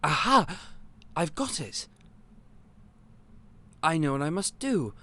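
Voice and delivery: a sudden brilliant idea hits; eureka excitement. Acted. Shopkeeper cracking the potion problem, thrilled exclaim.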